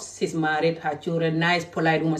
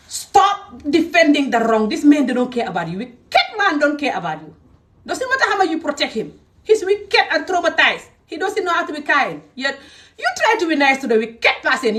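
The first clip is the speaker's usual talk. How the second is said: heated scolding; 6 semitones higher than usual. Real, not acted. Speaker berates women protecting wicked men, heated scolding. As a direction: scold them with long stressed words.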